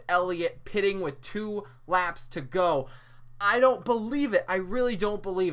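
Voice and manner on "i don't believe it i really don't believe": high, loud, stunned; can't believe what he saw; disbelief. Real, not acted. Stunned by pit call.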